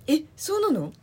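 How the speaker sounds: high, loud "え、そうなの？" of real surprise; surprised. Real, not acted. Friend surprised by the Hawaii mix-up, high and loud. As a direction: react with genuine disbelief.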